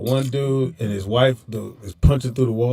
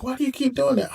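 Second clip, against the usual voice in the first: much higher, softer quoted woman's question; imitating questioning wife; 11 semitones higher, 6 dB quieter than usual. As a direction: lightly act out her puzzled complaint. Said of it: "And she was like".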